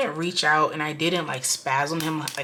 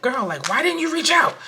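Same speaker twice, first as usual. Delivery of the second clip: high, loud challenge; questioning viewers; 9 semitones higher, 5 dB louder than usual. Acted. Viewers asking why she never reached out, imitated challenge. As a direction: pitch it up like a pressing girlfriend. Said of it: "If you guys ask me".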